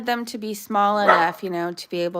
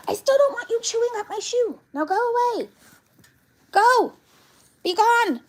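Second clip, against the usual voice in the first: high, fast exasperated shooing; high pitched go away; shooing dog; 13 semitones higher than usual. Real, not acted. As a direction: send the dog away, fed up.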